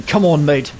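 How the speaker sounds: mock British "Come on, mate"; a cheery British mate voice, lightly done; British accent. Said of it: "a British accent saying".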